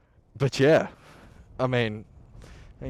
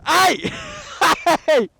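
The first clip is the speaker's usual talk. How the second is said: high startled shout with a laugh; startled yell; 8 dB louder than usual. Real, not acted. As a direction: yelp in surprise at a snowball. Snowball thrown at him.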